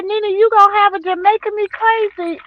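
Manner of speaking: high, loud grandchild chatter; excited child quote. Retold or imitated. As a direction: pipe it up like a thrilled kid. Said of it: "She said".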